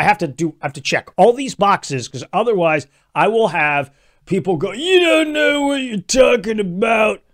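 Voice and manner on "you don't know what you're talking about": channeling Biden. Retold or imitated. Mocking Biden yelling at critics, high and slow. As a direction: yell it like an angry old Biden. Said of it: "I'm channeling Biden".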